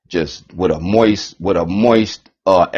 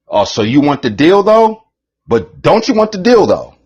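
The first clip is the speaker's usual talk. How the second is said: higher, louder coaxing rep; mock label rep; 7 semitones higher, 4 dB louder than usual. Label rep pitching the deal, mock coaxing. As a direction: tempt them like a shady record man. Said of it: "they say".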